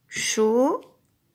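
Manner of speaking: "Chocolat" as three drawn-out syllables, long gaps between. Shown as a demonstration. Teacher claps "Chocolat" together with the child, syllable by syllable. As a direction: say "Chocolat" slowly, "cho" rising, then pause before each syllable. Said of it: "Je te laisse taper le mot".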